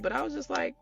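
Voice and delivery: ordinary talk, nothing marked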